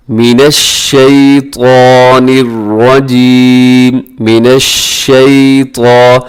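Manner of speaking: slow melodic Quranic chant; slow recitation. Shown as a demonstration. Teacher reciting the ta'awwuz for students. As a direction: draw out each word. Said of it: "teacher recites ta'awwuz".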